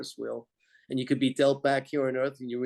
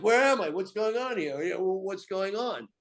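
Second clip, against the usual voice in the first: confused waking; 5 semitones higher than usual. Retold or imitated. Waking up confused after surgery.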